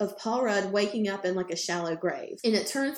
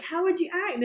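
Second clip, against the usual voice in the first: excited what-if; 9 semitones higher, faster than usual. Retold or imitated. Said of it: "And, like".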